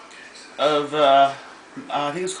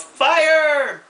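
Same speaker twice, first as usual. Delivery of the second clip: high, loud shouted command; yelled order; 10 semitones higher, 5 dB louder than usual. Acted. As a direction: bark it out like giving the order. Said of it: "points out and yells".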